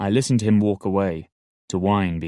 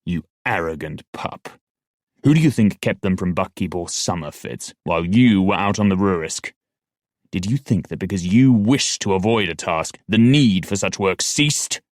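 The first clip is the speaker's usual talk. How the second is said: louder, rising scolding; angry rebuke; 5 dB louder than usual. Acted. Shade rebuking Fitz for shirking his task, angry. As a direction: lash him with it.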